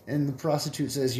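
usual speaking voice

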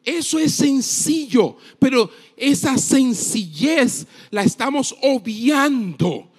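high-pitched preaching, stretching the key words; prédica enérgica. Real, not acted. Returns to preaching at full force. (predicación apasionada, voz elevada y palabras alargadas)

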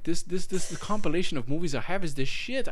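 loud, high victory claim; boastful insistence. Real, not acted. Boasting to co-host.

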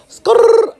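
high, loud rolled "skrrr"; trilled skrrr. Real, not acted. Playing up to viewers, high trilled skrrr. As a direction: trill a sharp, high screech like tires. Spelt imitated noise for effect.

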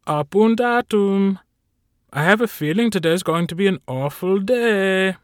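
cheerful fussy assistant; chipper servant Carl. Acted.

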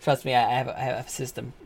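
normal talking voice

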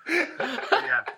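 usual speaking voice

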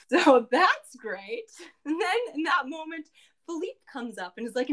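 ordinary delivery

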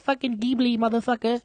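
put-on, high-pitched correction; mock outraged listener. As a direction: imitate an indignant listener correcting the host. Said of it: "one person that listened to this, he's like".

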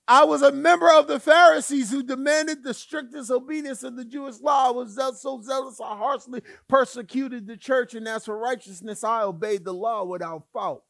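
a touch of proud swagger; boastful Paul. Acted. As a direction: puff out your chest and brag. Paul boasting, read loud.

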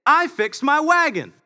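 put-on high, proud child's voice; proud little girl. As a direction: play the beaming little daughter. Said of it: "she looks at me proudly and says".

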